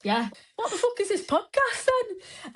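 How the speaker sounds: high, slow put-on voice; mock challenge. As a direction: demand it with hammed-up incredulity. Acting out star's challenge to them.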